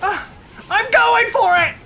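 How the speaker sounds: high, loud battle cry; excited shout. Real, not acted. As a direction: shout it as you charge in. Diving in during gameplay.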